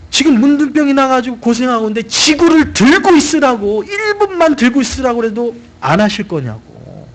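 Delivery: a loyal servant begging the general to just try; pleading servant. Retold or imitated.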